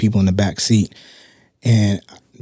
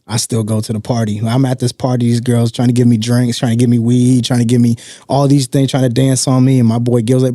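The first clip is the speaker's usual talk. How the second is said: ordinary talk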